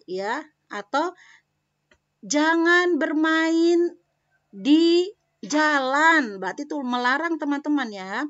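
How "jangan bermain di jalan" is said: slow, high and loud "Jangan bermain di jalan"; firm warning read slowly; forbidding tone. Shown as a demonstration. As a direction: draw it out like a teacher's warning. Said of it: "kalau kita melarang".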